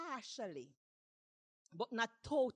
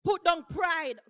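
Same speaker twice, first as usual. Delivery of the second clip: sudden full shout on the call; loud, emphatic preacher's exhortation; crying out; 7 semitones higher, 16 dB louder, slower than usual. Acted. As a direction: cry out an urgent command to the congregation. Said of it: "some of us need to cry out".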